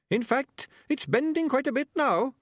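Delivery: high, small voice from a trap; small creature politely urgent, pitched right up; tiny high voice reporting trouble; little muffled voice. A narrator's reading. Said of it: "which was slightly muffled".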